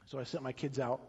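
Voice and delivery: soft low storytelling of a husband left alone; hushed lament. Acted. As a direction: let it sink into quiet grief. Hushed retelling as abandoned Hosea.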